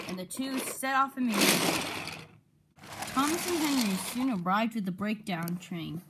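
ordinary delivery

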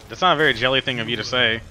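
ordinary delivery

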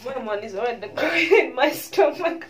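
loud, laughing through it; delighted disbelief; real joyful laughter; laughing in wonder. Real, not acted.

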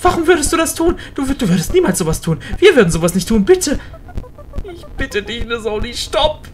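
frantic game character pleading; panicking friend. Acted. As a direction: beg desperately, rising into panic. Voices Klaus panicking.

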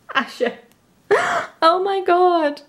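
near tears then a high squeal; overwhelmed. Real, not acted. Fan overwhelmed at her favourite's photo card, near tears. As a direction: choke up, then burst out.

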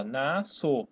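"nasço" drawn out slowly as na-so; "nasço" slow and low, pronounced na-so; demonstrated pronunciation. Shown as a demonstration. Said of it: "Eu pronuncio dessa maneira".

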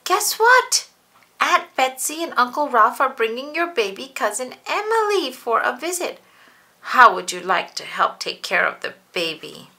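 high, loud eager news; excited mommy. Acted. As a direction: burst with happy news.

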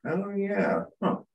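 high, light "Oh yeah, huh"; a pleased little discovery; surprised discovery. Retold or imitated. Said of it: "to kind of feel like".

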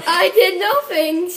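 high, loud, drawn-out protest; playful insistent denial. Pretend. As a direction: insist slowly on your innocence, loudly. Repeated denial when pressed.

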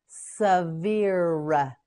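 "Severe" stretched as suh, veer; slow modelling. Shown as a demonstration. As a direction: draw out both syllables of "Severe". Teacher models word slowly.

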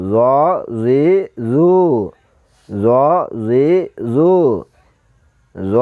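জ জি জু full-mouthed and soft, vowels held; thick, soft letter. Shown as a demonstration. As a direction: give জ a heavy, rounded yet soft sound. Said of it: "মোটা হবে এবং নরম হবে".

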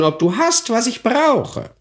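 sly crone sniffing for what she wants; croaking old hag. Acted. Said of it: "krächzender Stimme".

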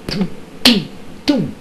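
high, short "toom" noises for punches; imitated punch sounds. Man punching the body in the casket, imitated punch sounds. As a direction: voice three punch sounds, spaced apart. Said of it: "and he went".

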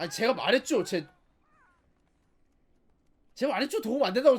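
loud complaining; annoyed. Real, not acted. Arguing loudly with game character.

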